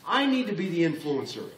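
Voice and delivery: fired-up demand of yourself; a mad listener's outcry; mad self-challenge. Retold or imitated. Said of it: "and said".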